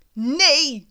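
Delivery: imitating a teacher shouting to stop pupils; retold shout. Teacher rushes to block the door, shouting. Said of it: "schreeuwde ze".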